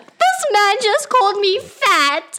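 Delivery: very high, loud, slow fake wailing; hammed-up crying for attention; making a scene. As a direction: sob it out to the whole room. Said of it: "He's like".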